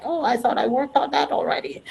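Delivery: voicing her own startled thought; mock surprise. Speaker quoting her inner self, mock dismayed. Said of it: "I'm like".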